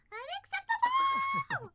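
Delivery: very high, loud, slow scream; high roaring shriek. Acted. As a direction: shriek it out in rage. Boss roaring in office.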